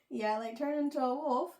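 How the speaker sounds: ordinary talk, nothing marked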